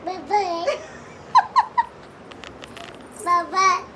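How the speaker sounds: put-on sing-song tease to the child; playful high goodbye; high teasing bye-bye.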